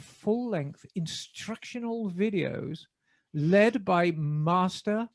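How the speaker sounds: measured BBC announcer reading an ad. Acted. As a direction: deliver the sponsor read in your best BBC British. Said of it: "in my best B B C voice".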